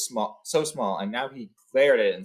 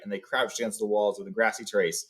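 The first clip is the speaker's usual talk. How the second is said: plain reading voice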